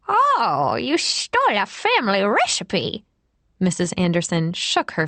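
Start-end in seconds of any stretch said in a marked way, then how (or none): 0.1-3.0 put-on high, fussy old lady voice; fussy old woman. A narrator's reading. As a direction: fret like a prim nursing-home lady. Same old woman character.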